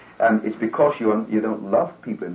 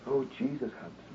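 much softer, pious sigh of an "Oh"; mock-sanctimonious, softer murmur; pious holy tone; 13 dB quieter than usual. Shown as a demonstration. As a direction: put on a holy droop, hushed and pious.

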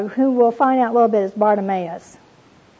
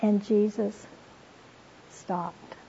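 hushed reading; 9 dB quieter, slower than usual. Acted. Dramatic reading of key words.

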